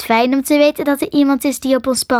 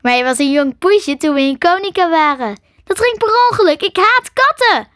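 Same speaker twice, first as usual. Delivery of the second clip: higher, louder, slowing at the end; laughing; 7 semitones higher, 4 dB louder than usual. Acted. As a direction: tease him through a laugh. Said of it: "Karin zei lachend". (lachend, hoger en luider)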